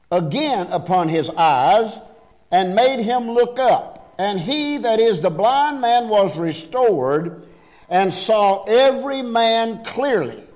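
high, ringing pulpit reading with drawn-out key words; scripture reading. Acted. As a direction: proclaim the Bible verses to the congregation. Reads scripture to congregation.

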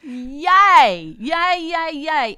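loud, bright yays of delight; excited cheering. Real, not acted. Guest cheering back at the host, loud and excited.

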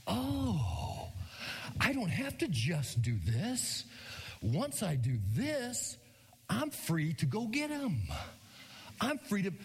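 put-on slow, wondering voice of a boy catching on; dawning realization. The son realizing on the field, slow and wondering. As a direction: let it dawn on you slowly. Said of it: "he all of a sudden goes".

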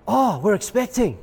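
"oh" lifted high in happy excitement; excited announcement. Acted. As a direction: gush it like happy baby news. Expectant couple announcing their news.

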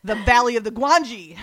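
loud, hammed-up command through laughter; mock stern order. Network boss dictating what viewers watch, mock stern and loud. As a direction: lay down the law as a joke.

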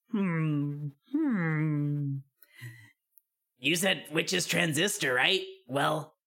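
a silly cartoon frog; goofy frog voice. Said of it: "the fucking goofy frog voice".

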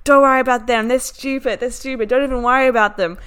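brisk, animated imitation of an indignant driver; emphatic dismissal. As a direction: wave away the worry insistently. Driver dismissing the agency's rejection.

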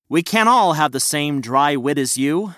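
usual speaking voice

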